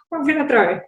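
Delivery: sheepish laughing request; laughing plea. Retold or imitated. Nervous newcomer begging director for replay, laughing. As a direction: ask again through a laugh. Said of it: "Sí, por favor".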